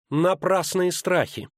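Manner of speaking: scornful, drawn-out dismissal; hissed through teeth. A narrator's reading. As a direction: spit it out slowly with contempt. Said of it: "процедила она".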